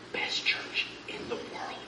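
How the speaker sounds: breathy stage whisper of a secret; hushed secret to the congregation. Acted.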